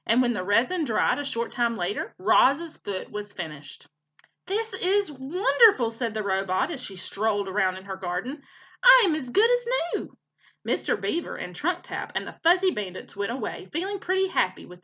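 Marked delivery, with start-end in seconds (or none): 4.5-5.9 high, slow, savoring delight. A narrator's reading. Robot tries her new wooden foot, delighted. 8.8-10.2 very high, louder, joyful declaration; elated. A narrator's reading. Robot strolls on her new foot, elated.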